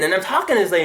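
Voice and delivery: usual speaking voice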